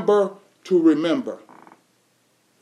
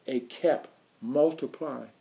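hushed emphasis; 7 semitones lower, 11 dB quieter than usual. Real, not acted. Repeat, hushed again.

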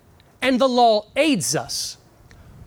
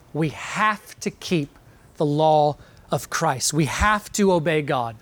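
weighty obligation; 6 semitones lower than usual. Acted. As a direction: lay down each word as a heavy duty. Voicing duty-bound words in question.

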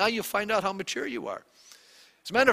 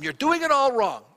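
louder, higher scolding snap; mock-impatient rebuke; 7 semitones higher, 6 dB louder than usual. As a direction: tell them off, running over them. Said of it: "You wanna run over them".

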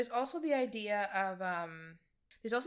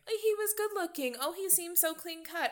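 high, airy imitation of swooning admirers; mock gushing; 9 semitones higher than usual. People talking about the killer's looks, mock gushing. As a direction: parody their fawning in a much higher voice.

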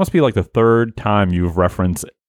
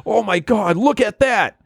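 excited; 11 semitones higher than usual. Acted. Beachgoer spots shining rock.

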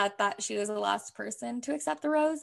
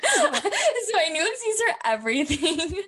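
laughing; 10 semitones higher, 5 dB louder than usual. Real, not acted. Laughing about shadow-banned Instagram.

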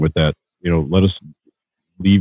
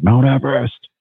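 high-pitched, restrained retold shout; mock shout; 8 semitones higher than usual. Climber addressing a photo of Everest, mild mock shout. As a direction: imitate a shout up at the mountain, pitched high without full volume. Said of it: "And he's shouting".

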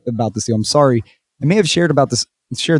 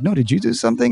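mock baffled; 8 semitones higher, faster than usual. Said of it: "And I'm like".

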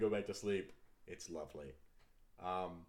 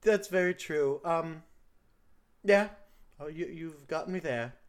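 put-on higher, louder girl voice with a wandering accent; shifting kid accent; 8 semitones higher, 8 dB louder than usual. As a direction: daughter conceding to her parent. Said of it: "your accent keeps changing".

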